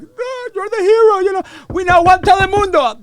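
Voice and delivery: high, loud cheering cry; cheering crowd. Retold or imitated. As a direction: hail him as the hero, shouting.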